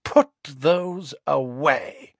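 slow, cold command; icy and unhurried, a haughty lady; coldly. A narrator's reading. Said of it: "she said coldly".

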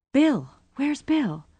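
high, drawn-out worried call; anxious. A narrator's reading. Rosie looking round for Bill, anxious. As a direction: ask it with rising alarm.

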